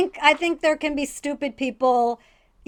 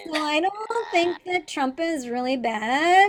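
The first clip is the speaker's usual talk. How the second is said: put-on dumb girl voice; 4 dB quieter than usual. Shown as a demonstration. Showing friends a political airhead, put-on dumb girl voice. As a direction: play a clueless girl, stretching the words. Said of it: "the dumb girl voice".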